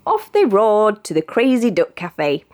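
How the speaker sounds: ordinary delivery, nothing marked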